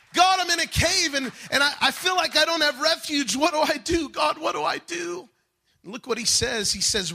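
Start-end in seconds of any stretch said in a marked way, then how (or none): 0.1-5.3 high, loud desperate plea; crying out. Acted. Preacher acts David crying to God from the cave, desperate cry. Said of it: "He's crying out to the Lord".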